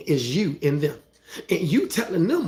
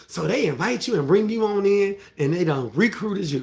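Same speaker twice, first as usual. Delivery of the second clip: ordinary talk, nothing marked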